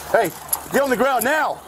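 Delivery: high, forceful police command; shouted surrender demands. Real, not acted. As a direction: order him down, no room to argue. Officer orders suspect to ground.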